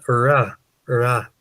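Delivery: ordinary talk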